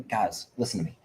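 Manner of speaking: usual speaking voice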